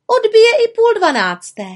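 very high, loud little witch; high-pitched singsong dismissal; breezy retort. Acted. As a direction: toss back a carefree answer high up. Witch brushes off raven's worry.

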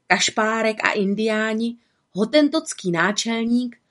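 plain reading voice

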